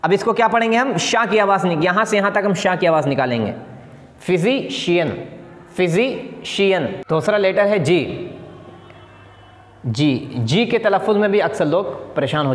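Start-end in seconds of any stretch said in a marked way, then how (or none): 4.2-6.9 "physician" slowly, fizi, pause, shiyan; broken into parts. Shown as a demonstration. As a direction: repeat "physician" in two separated parts. Teacher repeats word in parts.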